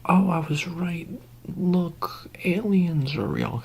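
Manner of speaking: high, drawn-out mock amazement; hushed, high-pitched mimicry of gullible onlookers; imitating the convinced public. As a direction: stretch it out high, like awed believers. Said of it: "they're gonna be like".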